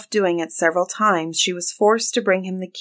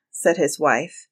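ordinary delivery, nothing marked

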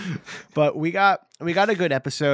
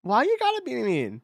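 very high, hurt-sounding mock protest; hammed-up pouty complaint, high and laughing; playful whining; 10 semitones higher, 4 dB louder than usual. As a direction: whine as if wounded by the teasing. Playful protest at being teased.

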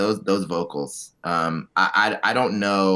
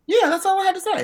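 squeaky voice; much faster than usual. Pretend. Squeaky silly reply.

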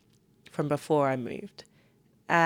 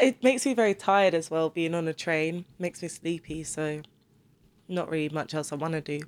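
ordinary talk

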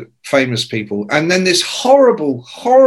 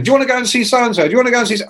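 quick, high-pitched pestering; keen nagging at double speed; pestering invitation; 9 semitones higher, much faster than usual. Retold or imitated. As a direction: rattle off the same eager invitation twice. Pestering friends to come along.

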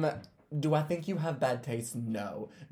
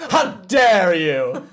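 a slow, loud, drawn-out how dare you; mock outrage; 11 dB louder, much slower than usual. Host reading her friend's outraged text aloud. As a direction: stretch it into hammed-up indignation. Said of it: "Kendall says".

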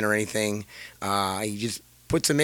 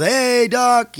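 high, drawn-out call to the doctor; retold yelling; 10 semitones higher, 3 dB louder than usual. Patient calling the absent doctor, mock yell. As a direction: imitate hollering for the doctor. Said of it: "I'm yelling".

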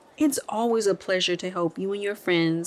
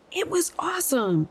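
put-on excited kids; 9 semitones higher than usual. A narrator's reading. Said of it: "Henry and his friends said".

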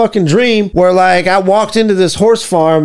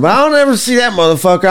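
ordinary delivery

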